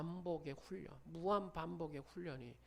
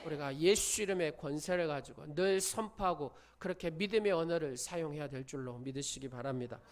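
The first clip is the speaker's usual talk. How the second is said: normal talking voice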